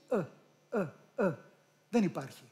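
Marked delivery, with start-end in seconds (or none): 0.1-1.3 "Ε, ε, ε" as three short central uhs; non-Greek vowel. Shown as a demonstration. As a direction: say each "ε" as an isolated foreign schwa. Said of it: "Δεν το έχουμε εμείς". («ε» ξένο, κεντρικό φωνήεν)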